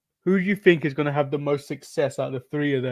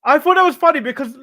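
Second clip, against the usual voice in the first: excited; 9 semitones higher, 7 dB louder, faster than usual. Real, not acted. Laughing exclamation.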